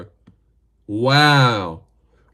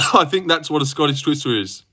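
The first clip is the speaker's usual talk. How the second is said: ordinary talk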